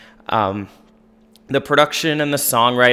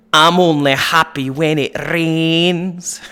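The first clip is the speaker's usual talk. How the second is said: thick Scottish brogue, slow and higher; Scottish accent; 6 semitones higher, 5 dB louder, slower than usual. Retold or imitated. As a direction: drawl it out in a heavy Scottish brogue. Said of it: "imagine a Garbage song with a thick Scottish brogue".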